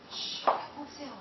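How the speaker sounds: fast frightened whisper; terrified whisper. Acted. Ida pleading with the unseen intruder, whispered terror. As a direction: beg in a rushed whisper.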